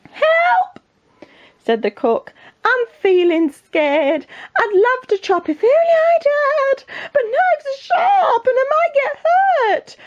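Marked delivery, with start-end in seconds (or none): 0.1-0.8 very high squealed cry for help; hammed-up storybook panic; panicked cook. Frightened cook asked to catch fish, squealing in panic. 2.6-10.0 very high, drawn-out wail; scared cook. Acted. Cook afraid of fishing for the king, high panicky wail.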